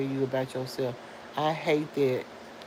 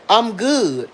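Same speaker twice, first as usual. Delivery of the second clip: firmer reply; 5 semitones higher, 8 dB louder than usual. Retold or imitated. Trish answers firmly, louder.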